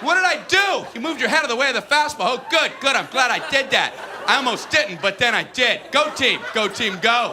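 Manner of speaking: high, breakneck-to-gushing mock relief; rattled kid batter. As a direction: babble with hammed-up relief, ending in a cheer. Rattled child batter acted out.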